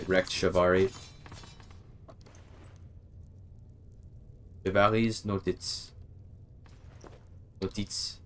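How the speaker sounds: German read with a French accent. Real, not acted. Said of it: "I read that like a Frenchman".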